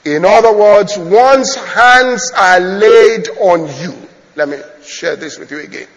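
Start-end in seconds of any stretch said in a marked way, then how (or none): 0.0-4.1 very slow, loud proclamation; forceful preaching. Real, not acted. Preacher proclaims laying on of hands, forceful preaching. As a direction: stretch each word out to the congregation.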